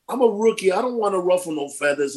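usual speaking voice

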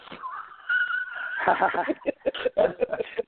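long high cackle at his own prank; real cackling laugh. Real, not acted. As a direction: burst into real delighted laughter.

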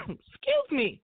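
coughing fit mid-sentence. Real, not acted. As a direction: talk through a cough. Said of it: "I'm choking myself out".

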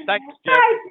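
high, loud teasing thanks; mock thanks. Real, not acted. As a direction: rib the sleepy husband. Teasing thanks to sleepy Jerry.

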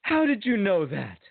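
high, surprised waiter's question; the waiter, amazed he guessed right. Retold or imitated. Waiter asks how he knew, high and surprised.

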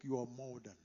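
ordinary talk

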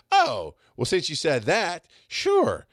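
tossing off an easy yes, pitched high; mock reply. Bandmate finally agreeing to help, mock high voice. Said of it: "He goes".

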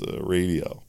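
usual speaking voice

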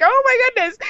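high, startled oh my goodness. Retold or imitated. Seeing the seatmate come her way, a high mock-horror squeak.

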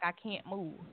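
barely audible, drained; weak and faint. Retold or imitated. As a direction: say it with no strength left. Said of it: "I was like".

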